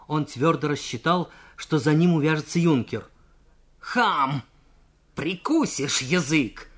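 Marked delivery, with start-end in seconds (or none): 3.8-6.7 angry cadet's shout, slowing on the threat. Acted. Junker chasing Nikolai onto the platform, shouting angrily. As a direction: bark the insult and the warning. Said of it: "крикнул юнкер".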